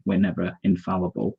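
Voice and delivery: ordinary talk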